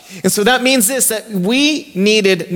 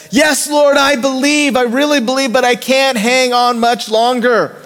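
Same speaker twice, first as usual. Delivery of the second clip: high, strained plea of a man barely hanging on; desperate pleading; 6 semitones higher than usual. Retold or imitated. Man clinging to a branch pleads with the Lord, high and strained. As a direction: beg the Lord while your grip slips.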